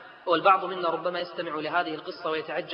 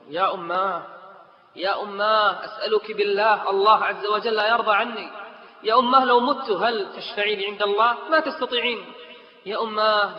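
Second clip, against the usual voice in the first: higher, louder desperate plea to mother; pleading daughter; 6 semitones higher, 5 dB louder than usual. Acted. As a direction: beg her mother earnestly.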